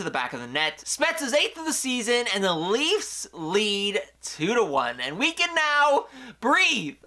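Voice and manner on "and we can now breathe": relieved excitement. Real, not acted. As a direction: breathe out and celebrate the lead. Relief at Leafs taking lead.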